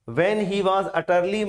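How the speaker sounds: When he was utterly read very slowly. Shown as a demonstration. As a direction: read it word by word. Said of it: "कब?".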